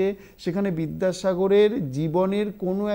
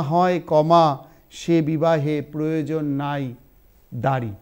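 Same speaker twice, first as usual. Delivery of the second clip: slow, loud dictation with long pauses between phrases; 6 dB louder than usual. Shown as a demonstration. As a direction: read it out phrase by phrase for students to write. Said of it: "আমি খুব আস্তে আস্তে বলছি". (ধীরে ধীরে শ্রুতিলিখন)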